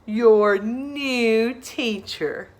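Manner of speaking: slow, drawn-out formal reveal; grand announcement. Acted. Principal introducing Sarah the new teacher, slow. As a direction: savor the big reveal.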